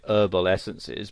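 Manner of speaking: herbal essences with no H, "erbal essences"; American-style herbal, opening on the vowel; silent H. Shown as a demonstration. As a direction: drop the H at the start of herbal. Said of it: "spells it that way".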